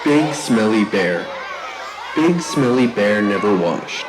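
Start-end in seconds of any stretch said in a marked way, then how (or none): none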